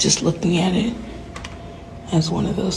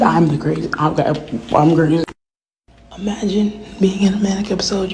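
normal talking voice

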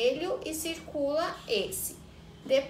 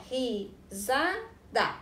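"risada" said slowly as ri-sa-da; teacher sounding out "risada" for the class; syllable by syllable; much slower than usual. Shown as a demonstration. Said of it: "as sílabas pra montar".

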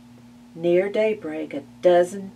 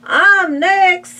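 very high, loud pig shout; pushy pig; 13 semitones higher, 12 dB louder than usual. Acted. Third pig claiming his turn, high loud squeal. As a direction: squeal it like a greedy piglet.

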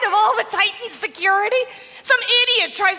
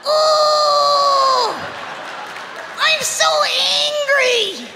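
mock nail clipper attacker; 6 semitones higher, much slower than usual. Comic plays a clipper-wielding attacker, hammed-up and high. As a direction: ham up a silly villain threatening with clippers. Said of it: "would you ever use a nail clipper as a weapon".

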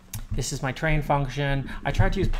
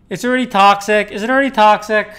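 mock outrage; 8 semitones higher, 13 dB louder than usual. Real, not acted. Chat mocking a project name.

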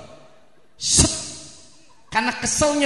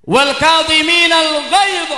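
quranic recitation; 6 semitones higher, 5 dB louder, slower than usual. Acted. Quotes Quran verse in sermon.

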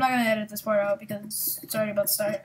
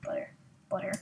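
whispered butter; 4 semitones lower, 12 dB quieter, faster than usual. Shown as a demonstration. Gamer whispering the banned nickname to viewers. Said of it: "I'm sorry, gold".

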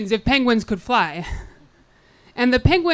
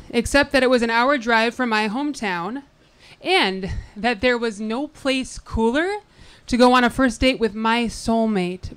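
ordinary talk, nothing marked

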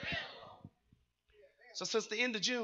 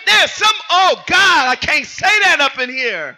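loud, rhythmic preaching shouts; full-force preaching; 5 semitones higher, 7 dB louder than usual. Real, not acted. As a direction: fire up the congregation with each line.

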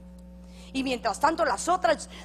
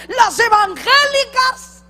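slow, high outraged cry; preacher shouting scandalized at the congregation; indignant shout; 11 semitones higher, 13 dB louder, slower than usual. Real, not acted. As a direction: hurl "evangélicas" like an accusation. Preacher denouncing church girls.

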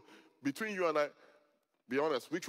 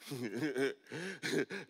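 long wordless chuckle; 3 dB quieter than usual. Real, not acted. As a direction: chuckle to yourself before going on. Amused after telling the story.

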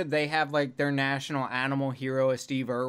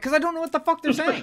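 high, rushed exasperated retort; 12 semitones higher, faster than usual. Retold or imitated. Answering people recommending Clockwork Orange, exasperated retort. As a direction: blurt it out fast and high, exasperated.

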